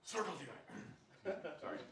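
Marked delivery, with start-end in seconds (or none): 0.0-0.6 high, loud blurt passed off as a cough; fake choked-up cough. Speaker jokingly butts in, then blames being choked up, mock cough. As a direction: cough out the name as if choking. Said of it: "I got a little choked up there".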